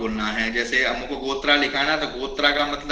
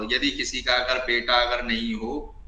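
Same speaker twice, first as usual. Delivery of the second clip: ordinary talk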